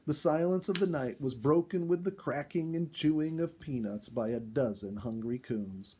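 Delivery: ordinary delivery, nothing marked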